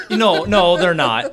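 very loud, through laughter; laughing agreement. Real, not acted. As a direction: laugh heartily as you agree with the joke. Laughing along with the sarcastic joke.

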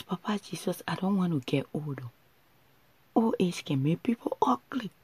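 normal talking voice

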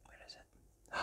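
hushed whispered question. Acted. Reader whispering to the child, looking around. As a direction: whisper it, searching.

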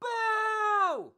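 high, far-off jeer from one fan; lone crowd boo. Retold or imitated. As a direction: boo from the back like a lone heckler. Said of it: "One guy went".

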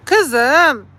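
loud, high call to the cat. Real, not acted. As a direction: call her from afar. Calling the cat. (yüksek sesle seslenme)

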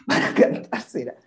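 high laughing exclamation. Real, not acted. Recalling the singer doing [name], high amused laughter. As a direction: laugh it out in disbelief.

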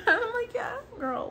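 laughing through the words, high. Real, not acted. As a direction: still giggling, cheering her on. Laughing while quoting self.

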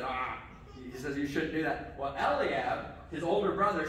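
ordinary talk

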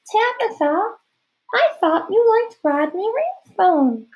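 very high, put-on girlish falsetto; a boy probing in a fake girl voice; high, slow and falsely dainty; disguised girl voice. A narrator's reading.

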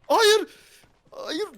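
high, drawn-out wail; anguished wail. Real, not acted. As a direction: cry out in dismay. Teammate gets shot.

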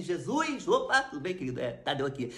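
very soft, chatty aside to Jesus; hushed casual prayer. Retold or imitated. Bus-stop victim praying to Jesus before a robbery, hushed. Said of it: "Falei assim".

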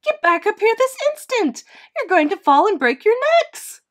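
high, very loud mother bird scolding; alarmed cry. A narrator's reading. Mama Bird orders babies back up, crying out in alarm. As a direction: shout in high-pitched panic at the children. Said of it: "she cried".

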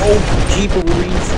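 high, odd squeal of surprise; weird squeaky exclamation. Real, not acted. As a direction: yelp out a strange noise. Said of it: "I made a weird sound".

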